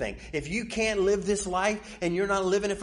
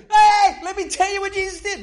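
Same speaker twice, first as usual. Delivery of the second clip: high, loud, fast joyful shouting; excited shout; 13 dB louder than usual. Acted. Healed people running out to tell everyone, a joyful shout. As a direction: yell the good news like you can't keep it in.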